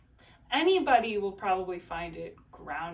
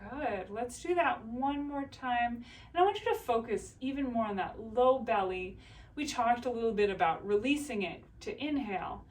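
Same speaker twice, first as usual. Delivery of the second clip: ordinary delivery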